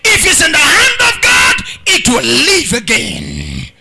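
loud, slow declamation with drawn-out phrase ends; thundering revival preacher; full-force preaching. Real, not acted. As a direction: drive each line home to the congregation.

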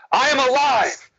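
high, emphatic declaration; like you mean it. Retold or imitated. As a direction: declare it with full conviction. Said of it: "Say it like you mean it".

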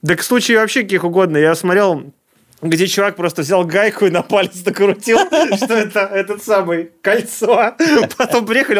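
ordinary talk, nothing marked

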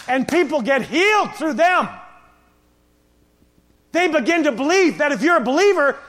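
loud, high, driving preacher; sermon climax, shouting the point; preaching at full force. Acted. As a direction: build it to a shout.

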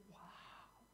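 breathless whispered wow; awed whisper. Retold or imitated. Adam seeing Eve for the first time, whispering in awe. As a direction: whisper it in wonder.

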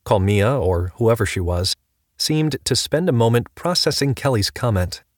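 ordinary talk, nothing marked

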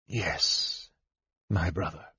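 soft, low voice of an uncanny brother; Raistlin. A narrator's reading. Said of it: "said Raistlin, answering his brother's thoughts".

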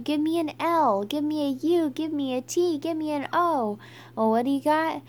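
rally the crowd letter by letter; yelled cheer. A narrator's reading. Skunk leads the underdogs in a Pluto cheer, storybook yell. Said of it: "Skunk yelled".

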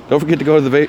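ordinary talk

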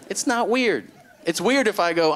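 high-pitched, indignant correction; mock-indignant, voice jumping up; exasperated rebuttal. Exasperated rebuttal in acted argument.

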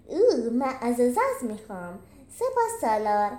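put-on pompous general in a ridiculous Gaulish accent; a hint of a comic Gaulish accent; silly Gaulish accent. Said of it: "این لهجهٔ مسخره دیگه چیه".

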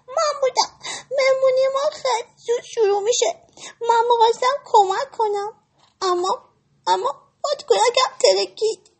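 high, teary little rabbit voice; trembling voice. Acted. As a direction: confess nervously, close to tears. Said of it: "با صدای لرزونی گفت".

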